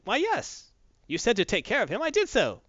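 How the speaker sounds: high, chirpy mock-innocent droid. Droid answers the boss, high and innocent. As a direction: act like you did exactly as told.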